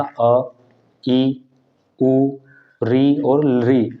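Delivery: री और री spoken very slowly; slow careful vowel recital; slow vowel demo. Shown as a demonstration. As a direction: spell out री और री slowly for the class. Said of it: "For example".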